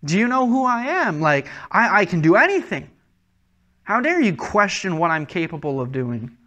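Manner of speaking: high, loud indignant challenge at first, easing down; mock affronted outburst; offended. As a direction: act out wounded authority, incredulous. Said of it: "almost a sense of offense".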